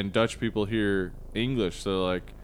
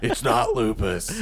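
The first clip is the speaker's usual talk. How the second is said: grumbled American doctor impression; House. Doing a perfect TV doctor impression, grumbled verdict. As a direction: pronounce it like a surly TV doctor. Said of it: "this is my perfect house impression".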